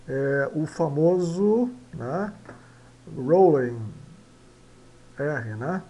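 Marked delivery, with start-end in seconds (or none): 3.2-3.8 teacher modelling "rolling"; pronunciation demo. Shown as a demonstration. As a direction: glide the R of "rolling", no tap.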